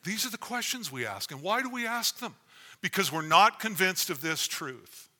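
fervent full-voiced sermon; preaching.

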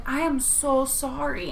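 slow, softened, drawn-out apology; mock-contrite and slow; exaggerated apology. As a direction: act out a pleading apology, stretching the words. Acted apology to angry old lady.

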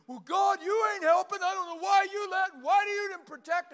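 loud, high accusing whine at God; hammed-up complaining prayer; whiny complaint. Believer blaming God for not protecting, hammed-up whine.

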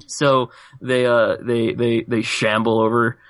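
ordinary talk, nothing marked

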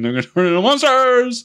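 high, loud panicked exclaim; mock shriek of realization; mock alarmed cry. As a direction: sell the horror of the twist. Characters' realization voiced.

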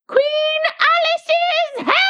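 very high, drawn-out shrieking toast; scream at the top of her voice. A narrator's reading. Red Queen toasts Alice's health, very high drawn-out scream. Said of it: "she screamed at the top of her voice".

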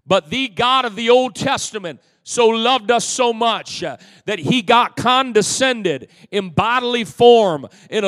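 loud, rising sermon cadence; preaching at full force. Acted. Pastor preaching loud to his congregation. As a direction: preach it out big to the church.

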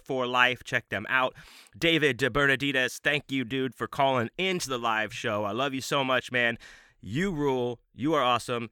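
ordinary talk, nothing marked

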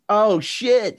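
surprised cop. Retold or imitated. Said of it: "And he goes".

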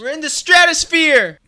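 high, loud, hyped-up announcement; excited mock launch shout. Countdown over, announcing the launch, high excited shout. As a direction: shout it like blast-off.

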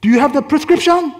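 impatient husband. Retold or imitated. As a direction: call out demandingly as the husband. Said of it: "And he goes".

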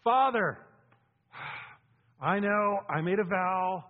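loud, pleading, high pleading to Father; hammed-up reluctant servant praying loudly; mock dutiful prayer. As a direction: whine out a reluctant duty-bound vow. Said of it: "He didn't say".

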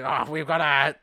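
loud, failed boss impression; Vince McMahon. Podcaster retries the boss impression and gives up, badly faked loud voice. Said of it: "I can't do it".

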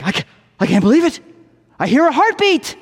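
high-pitched mock amazement, stumbling start; astonished patient. Patient hears his own heart beating, astonished. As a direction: play the stunned discovery for comedy. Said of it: "eyes grow wide and his face drops".

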